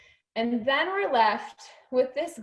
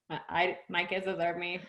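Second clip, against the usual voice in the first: dull, lower, softer, empty recital; flat rote reading; 5 semitones lower, 6 dB quieter than usual. Shown as a demonstration. Coach mocks affirmation said without feeling, flat. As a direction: say it like meaningless words. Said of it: "it doesn't feel anything".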